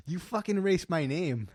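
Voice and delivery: imitating an angry stranger; retold pissed-off accusation. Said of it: "he was pissed".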